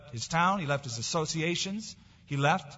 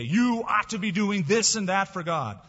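higher, pressing mock scolding; finger-pointing accusation; 4 semitones higher, 4 dB louder than usual. People lecturing guilt-ridden believers on their duty, mock accusing. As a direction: imitate someone laying down religious duty. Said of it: "fingers are pointed at them".

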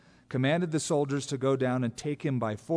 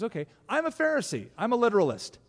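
Paul declaring; faster than usual. Acted. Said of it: "He goes".

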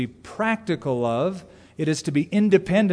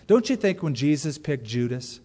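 usual speaking voice

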